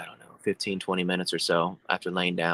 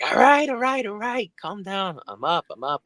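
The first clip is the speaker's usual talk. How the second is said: panicked placating; 12 semitones higher than usual. Retold or imitated. Flustered husband placating angry wife.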